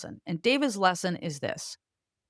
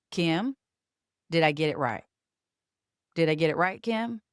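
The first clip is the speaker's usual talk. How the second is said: put-on lower-pitched, deliberate Appalachian accent; David; 3 semitones lower than usual. Old boss asking his young employee Kim, in an Appalachian accent. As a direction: imitate a mountain North Carolina accent, with intention. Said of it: "he also speaks very slowly and with intention".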